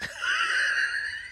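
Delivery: squealing high laugh at herself; high giggle. Real, not acted. Laughing at her own impulse buy.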